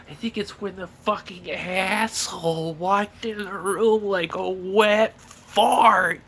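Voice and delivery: high, loud, slow hammed-up rant; angry outburst. Leader accusing the newcomer, loud slow mock anger. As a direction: drag out the insult in mock fury. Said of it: "And he just goes".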